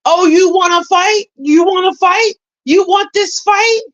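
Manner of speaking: high taunting "you wanna fight?"; mocking taunt. To the vlogger, retold taunt egging a fight, high and mocking. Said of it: "basically egging me on, like".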